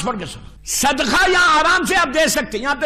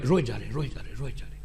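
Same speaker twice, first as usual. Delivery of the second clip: hushed sorrow; 11 dB quieter, much faster than usual. Acted. Prophet weeping in the story.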